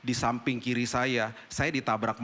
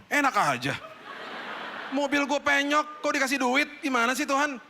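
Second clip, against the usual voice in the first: indignant protest; 10 semitones higher than usual. Retold or imitated.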